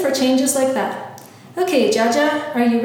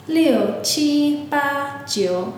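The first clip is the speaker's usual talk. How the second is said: slow; 2 semitones higher, much slower than usual. Shown as a demonstration. Host repeats counting again.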